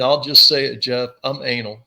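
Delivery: ordinary talk, nothing marked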